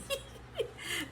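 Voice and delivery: a quick high giggle with little voice; high airy laugh. Real, not acted. Giggling after mocking the runner for her viewers. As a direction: laugh at your own cheeky line.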